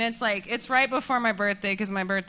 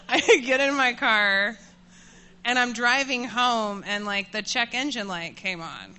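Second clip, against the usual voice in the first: usual speaking voice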